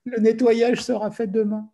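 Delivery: lightly voiced Russian defence minister brushing it off; breezy Russian minister. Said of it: "Il dit".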